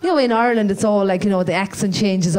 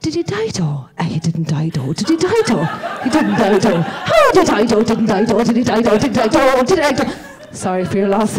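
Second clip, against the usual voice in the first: two Cork women trading lines in a singing Cork lilt; exaggerated Cork accent back and forth; sing-song Cork accent. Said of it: "never knew if people were singing or talking".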